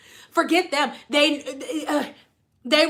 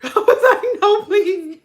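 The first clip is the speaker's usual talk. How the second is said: laughing plea; 4 semitones higher, 5 dB louder than usual. Retold or imitated. Pleading while laughing.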